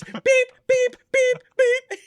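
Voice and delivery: loud, very high, drawn-out beeps; imitated scanner beeps. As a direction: mimic a checkout scanner beeping loudly. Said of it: "it's supermarket sweep. It's beep".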